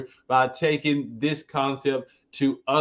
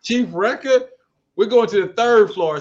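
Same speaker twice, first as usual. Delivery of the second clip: hype announcer; 6 semitones higher, 7 dB louder than usual. Acted. Announcing elevator floor.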